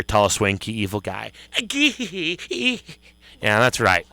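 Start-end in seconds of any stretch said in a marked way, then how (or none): none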